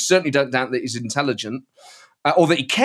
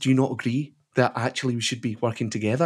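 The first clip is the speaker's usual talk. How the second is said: a hint of a soft-spoken Scottish politician, a bit quieter; Douglas Ross impression; 4 semitones lower, 6 dB quieter than usual. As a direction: speak it gently and reasonably, slightly hushed. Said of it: "Douglas Ross impression".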